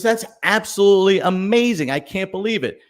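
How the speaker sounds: high, loud burst of wonder; amazed farmer. Acted. Farmer reacting to the salesman's cow story, hammed-up amazement. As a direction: gush like the farmer can't believe his ears.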